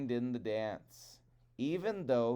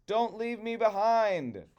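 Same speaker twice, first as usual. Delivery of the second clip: high, louder pleading cry; 10 semitones higher, 5 dB louder than usual. Acted. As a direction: plead as they fly off. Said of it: "he cried out".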